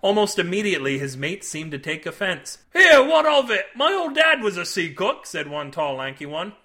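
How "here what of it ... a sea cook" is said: high, indignant deckhand voice; offended sailor. A narrator's reading. Tall lanky sailor defending his dad, indignant. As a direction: bristle at the insult.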